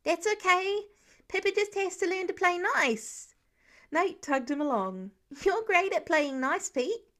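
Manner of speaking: put-on high, hopeful child's voice; pleading little boy. A narrator's reading. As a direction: coax big Pete into helping. Voiced youngest son Nate.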